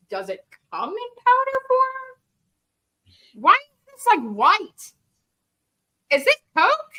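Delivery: high, slow, bewildered questions; high confused disbelief; baffled. Retold or imitated. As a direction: puzzle over the strange powder. Confused realization, high far.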